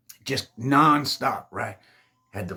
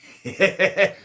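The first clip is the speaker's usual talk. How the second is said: burst of laughter; 9 semitones higher, 7 dB louder than usual. Real, not acted. Laughs at own aside.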